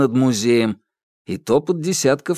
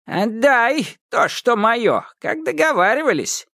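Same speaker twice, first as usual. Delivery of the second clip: witch voice; 12 semitones higher than usual. A narrator's reading. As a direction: demand it back like Baba Yaga. Yaga demands her due. (голос Бабы Яги, требовательно)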